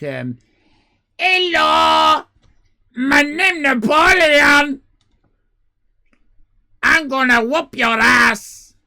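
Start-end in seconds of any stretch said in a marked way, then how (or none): 1.2-8.8 put-on very high, very loud, slow shouted accent; hammed-up French emperor bellowing a threat; Napoleon voice. Streamer showing how Napoleon really spoke, shrill shouted bluster. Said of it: "he spoke".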